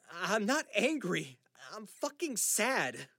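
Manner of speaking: loud, high, slow outburst; anguished outburst. Acted. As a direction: force it out in pain. Son's outburst at his father.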